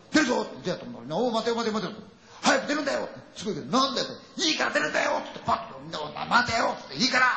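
high-pitched, frantic urging; panicked urging. Retold or imitated. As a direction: push everyone out in rising panic. 怯えて出ようと叫ぶ仲間たち. (恐怖で声が裏返るように急かす)

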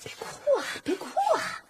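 high, soft coaxing; soothing. Acted. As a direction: gently hush a crying girl. Comforting crying Feifei. (高声轻柔地哄劝)

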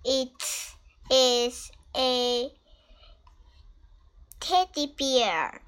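gentle, sing-song picture book read; reading to a toddler. Acted. Parent reads Biscuit book to child.

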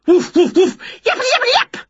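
high-pitched, loud barking imitation; yapping dog. A narrator's reading. Dog bursting in as Winnie opens the door, yapping. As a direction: woofs rising to squeaky yaps.

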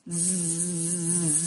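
buzzing. Acted. Fly buzzing off alone.

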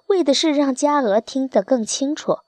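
ordinary delivery, nothing marked

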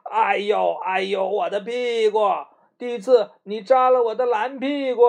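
hammed-up cry over a pricked bottom; mock yelp of pain. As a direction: Ice Melon yelping at the Spikeweed, mock pain. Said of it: "大声地叫".

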